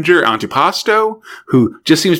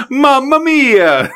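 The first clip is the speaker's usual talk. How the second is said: high, slow, hammed-up Italian accent; fake Italian accent; 12 semitones higher, much slower than usual. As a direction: stretch it out in a cartoon Italian accent. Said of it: "my fake Italian accent".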